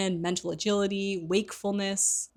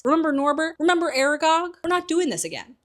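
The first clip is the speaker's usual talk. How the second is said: much higher, louder exasperated protest; 10 semitones higher, 6 dB louder than usual. Retold or imitated. Ron refusing another of Hagrid's creatures, exasperated. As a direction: throw your hands up and protest.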